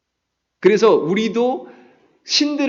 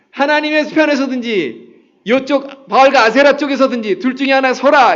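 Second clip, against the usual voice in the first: louder, higher prophet's challenge; Elijah's challenge; 5 semitones higher, 6 dB louder than usual. Acted. Elijah to the people on Mount Carmel, challenging. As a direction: call out the ultimatum forcefully, pitch raised. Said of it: "마치 갈멜산에서 엘리야가".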